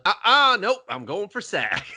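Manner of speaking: high defiant refusal; high sassy voice. Retold or imitated.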